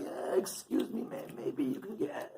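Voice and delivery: soft, hesitant, nearly voiceless approach; meek, halting murmur; timid beggar. Acted. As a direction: ask for money like you dread asking. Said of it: "wasn't that type".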